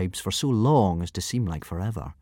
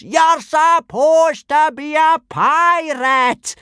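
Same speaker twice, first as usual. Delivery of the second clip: slow, much higher and louder howl; 9 dB louder, much slower than usual. Acted. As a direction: howl it in despair. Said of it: "howled Gobber".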